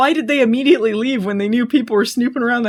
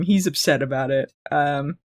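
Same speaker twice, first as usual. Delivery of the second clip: ordinary talk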